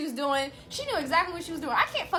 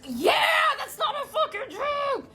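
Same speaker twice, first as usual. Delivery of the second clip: higher, louder, slowed indignant retort; indignant shout; 12 semitones higher, 4 dB louder than usual. Retold or imitated. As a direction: yell it back at her in disbelief. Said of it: "And we're like".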